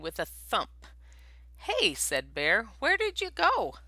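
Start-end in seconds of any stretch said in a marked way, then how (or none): 1.6-3.8 high puzzled bear call. A narrator's reading. Bear looks for vanished Mouse.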